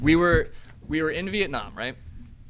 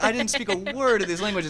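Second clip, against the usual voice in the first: ordinary talk, nothing marked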